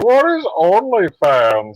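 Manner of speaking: high, slow baffled question; puzzled and drawn out; confused. Retold or imitated. Zod clueless, quoted.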